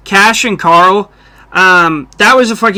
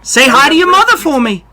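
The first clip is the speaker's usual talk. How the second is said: higher, pushed-up Wahlberg voice; Mark Wahlberg; 6 semitones higher, faster than usual. Retold or imitated. Host imitates Wahlberg talking up high. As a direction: talk up in the throat like Wahlberg. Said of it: "always just talking up here like this".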